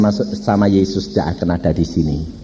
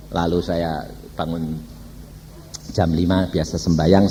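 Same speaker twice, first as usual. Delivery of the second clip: normal talking voice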